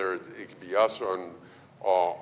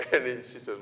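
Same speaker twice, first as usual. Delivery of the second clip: ordinary talk, nothing marked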